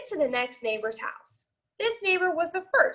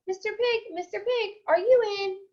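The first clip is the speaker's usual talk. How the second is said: higher, sing-song call; 6 semitones higher than usual. Retold or imitated. Wolf calls to the pig at the brick house, high sing-song. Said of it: "I called".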